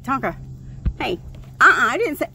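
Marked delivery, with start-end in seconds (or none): none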